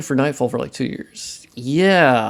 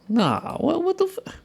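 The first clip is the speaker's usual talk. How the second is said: high incredulous squeak. Real, not acted. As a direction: voice leaps high in disbelief. Frustrated squeak at the software.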